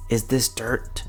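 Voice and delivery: ordinary talk, nothing marked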